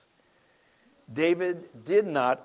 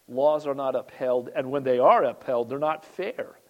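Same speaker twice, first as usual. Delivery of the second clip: ordinary delivery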